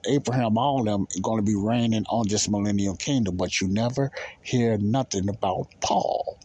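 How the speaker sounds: normal talking voice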